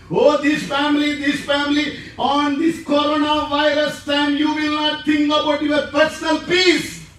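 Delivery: high, pleading call; impassioned plea. Real, not acted. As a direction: cry out for the family.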